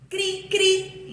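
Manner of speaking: high-pitched "cri, cri" sound effect; two bright-pitched onomatopoeic chirps; cricket chirp imitation. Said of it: "palavras que representam som".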